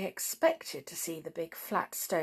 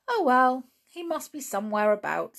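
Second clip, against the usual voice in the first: upbeat, lifted opening, louder at first; cheerful; 7 semitones higher, 6 dB louder than usual. A narrator's reading. Julian reassuring his aunt about the missing uncle, cheerfully. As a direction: brush the worry off brightly. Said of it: "said Julian cheerfully".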